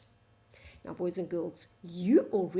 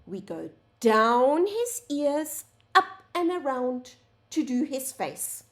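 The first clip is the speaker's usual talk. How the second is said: drawn out, emphatic on the strokes; slow demonstration; 4 semitones higher, 3 dB louder than usual. Shown as a demonstration. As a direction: guide the letter stroke slowly. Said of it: "Let me show you again".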